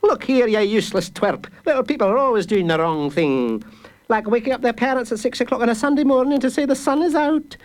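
high, fast, scornful guard voice; guard berating a child, high and scornful; scolding guard. A narrator's reading. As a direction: tear into the useless twerp.

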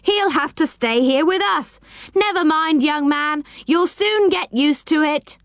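high-pitched mocking consolation; high, taunting character voice; jeering crowd cry. A narrator's reading. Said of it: "they cried".

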